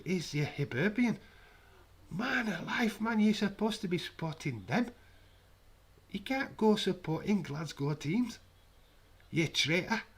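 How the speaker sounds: hammed-up Edinburgh indignation; mock outrage. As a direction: scold your mate as a football traitor. Mock outrage over football team.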